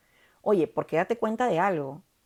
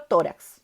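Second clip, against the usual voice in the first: tórax with a louder tó; stress on first syllable; 2 semitones lower, 5 dB louder than usual. Shown as a demonstration. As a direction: punch the tó of tórax, let rax fall. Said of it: "Penúltima sílaba es la fuerte".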